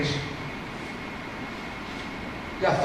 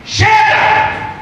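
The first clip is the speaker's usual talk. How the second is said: sudden loud, high cry of "enough"; preacher's shout; 8 dB louder than usual. Real, not acted. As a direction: burst out in a fervent shout. Preacher cries out against lukewarm faith. (brado alto de basta)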